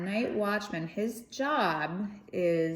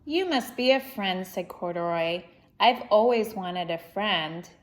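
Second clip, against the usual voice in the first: lively, expressive storybook reading; reading to children; 2 semitones higher, 6 dB louder than usual. Acted. Reading Corduroy aloud to children, lively storybook voice. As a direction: read it out to a young audience with energy. Reads picture book aloud.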